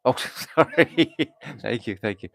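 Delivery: laughing off a slip; embarrassed laugh. Real, not acted. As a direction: laugh sheepishly, then thank them.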